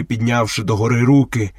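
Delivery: usual speaking voice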